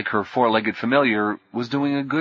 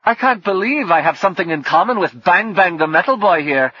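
higher and louder, panicky; edge of panic; 8 semitones higher, 6 dB louder than usual. A narrator's reading. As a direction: let panic creep into it. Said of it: "a thin edge of panic in his voice".

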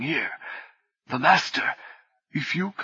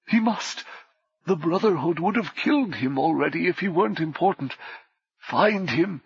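usual speaking voice

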